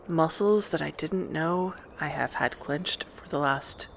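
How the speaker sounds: ordinary talk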